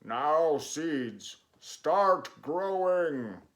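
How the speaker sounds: loud, slow, stretched shout at the seeds. A narrator's reading. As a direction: bellow it down at the ground, slow. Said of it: "and shouted".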